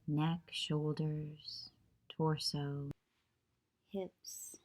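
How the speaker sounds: calm, even meditation voice with long pauses; unhurried yoga nidra guidance, leaving long silences; guided meditation. Acted. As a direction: guide them gently into stillness, phrase by phrase.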